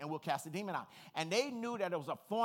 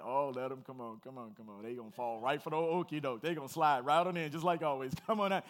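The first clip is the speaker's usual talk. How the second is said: low, sly mock taunt ending in a laugh; a hint of a smug demon; 5 semitones lower, 4 dB louder than usual. Demon mocking believers, sly and smug. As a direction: lure them in lightly. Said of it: "it's like".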